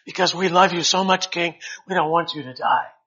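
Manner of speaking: hammed-up courtier pleading with the king; fawning subject. Subject flattering the proud king, loud and fawning. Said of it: "you better say".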